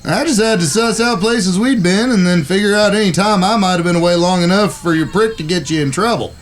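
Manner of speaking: pleased as punch, pitched well up; eager cowboy brother. Acted. Brother hurrying his siblings to the stagecoach, high and eager.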